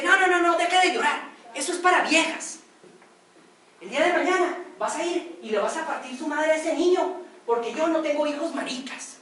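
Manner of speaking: regaño fuerte y más agudo de padre furioso; grítale al hijo que deje de llorar; padre enojado. Acted. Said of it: "el papá se enoja". (padre enojado regañando a gritos)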